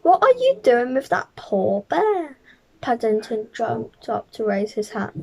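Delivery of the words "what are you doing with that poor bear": scolding. Acted. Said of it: "cried Miss Brown".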